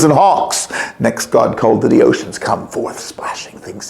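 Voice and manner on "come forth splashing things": hushed unvoiced call to the sea; soft secret summons; whispered call. A narrator's reading. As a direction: breathe it out like waves. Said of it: "he called".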